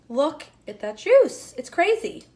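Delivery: slow, amazed, loud delight; excited. Real, not acted. Cook marvelling at her own chicken, loud and amazed.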